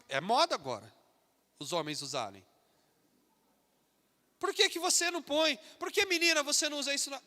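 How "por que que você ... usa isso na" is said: high, jeering schoolyard taunt, mildly hammed; a light imitation of mocking teens; mocking classmates. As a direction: sneer at the believer's clothes like a teasing kid. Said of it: "Vão começar a chacotear".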